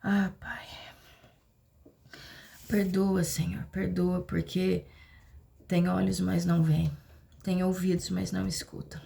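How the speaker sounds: hushed pleading prayer; whispered prayer. Acted.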